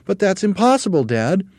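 very high, loud protest; small monkey crying out. A narrator's reading. Small monkey to dad, protesting cry. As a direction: object in a squeaky little voice. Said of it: "cried one of the small monkeys".

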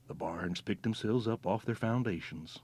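ordinary delivery, nothing marked

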